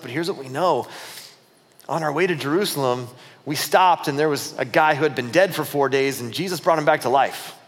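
ordinary delivery

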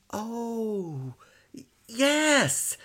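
put-on high little-boy recollection; remembering child. A narrator's reading. Son remembers to father, childlike. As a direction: recall it brightly. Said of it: "Seriotza remembered".

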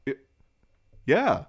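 high, loud eager yes; excited. Retold or imitated. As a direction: stammer then jump to an eager yes. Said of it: "he just goes".

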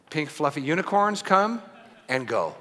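normal talking voice

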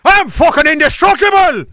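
high, loud Irish boast; Irish accent. Acted. Undying clansman boasting to his enemies, loud Irish accent. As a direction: shout it in a hammed-up Irish brogue. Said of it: "that was Ireland".

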